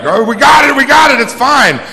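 high, loud imitated guard shout; frantic shout. Security rushing out of the woods, frantic high shout. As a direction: call out urgently as security rushing in.

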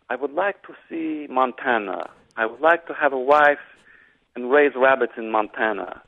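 a dreamy Russian officer in a lightly put-on Russian accent; Sam Neill Russian accent. Guest quoting the movie's Russian officer, light Russian accent. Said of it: "Sam Neill in his best Russian accent is like".

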